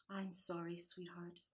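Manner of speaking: soft, gentle consoling mother; tender regret. Acted. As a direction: let him down gently and softly. Mother comforting sad son.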